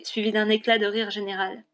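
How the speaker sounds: usual speaking voice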